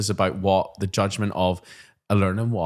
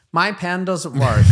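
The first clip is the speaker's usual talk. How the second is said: put-on higher, slow whining child voice; whiny child; 8 semitones higher, 5 dB louder, slower than usual.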